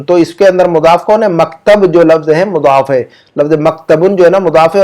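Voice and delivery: ordinary talk, nothing marked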